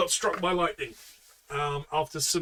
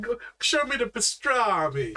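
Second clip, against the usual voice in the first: higher-pitched mock Brooklyn voice; Brooklyn accent; 6 semitones higher than usual. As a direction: ham it up like a Brooklyn local. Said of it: "a slightly Brooklyn accent".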